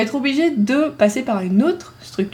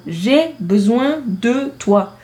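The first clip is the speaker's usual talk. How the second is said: slow example; much slower than usual. Shown as a demonstration. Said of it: "Ça, c'est logique".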